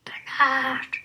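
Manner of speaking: unvoiced whispered call. Otters softly whispering for the lost Bernard. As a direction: whisper his name into the dark.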